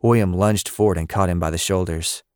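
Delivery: usual speaking voice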